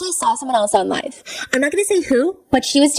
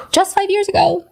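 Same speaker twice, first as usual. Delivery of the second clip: much higher, incredulous; high sarcastic echo; mock disbelief; 9 semitones higher than usual. Real, not acted. Incredulous repeat.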